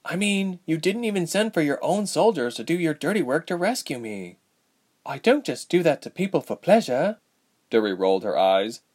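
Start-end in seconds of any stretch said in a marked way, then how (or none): 0.0-4.4 higher-pitched, questioning character voice; lightly voiced skeptical young woman; young heroine, wry. A narrator's reading. Narrator voices Ilse. 5.1-7.2 higher-pitched, mildly indignant royal; lightly acted, put-out princess voice; young princess, defensive. A narrator's reading.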